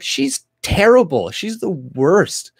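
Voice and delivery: hammed-up disgust, dragging each word; mock outraged retort. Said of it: "I'm like".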